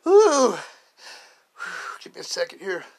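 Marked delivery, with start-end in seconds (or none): 0.0-0.6 long helpless laugh, higher and louder; long real laugh. Real, not acted. Regaining composure mid-story, a long real laugh. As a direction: let the laugh run, then blow out air. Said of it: "Get my composure again".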